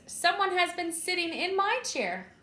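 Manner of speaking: Mama Bear's middle-sized voice. A narrator's reading. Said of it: "She said in her middle-sized voice".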